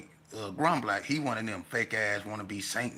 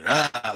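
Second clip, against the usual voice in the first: loud mock growl; scary shout; 3 semitones higher, 10 dB louder than usual. Classmate lunging to scare Jay, loud mock growl. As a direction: bark it to scare someone. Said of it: "like he's trying to scare Jay".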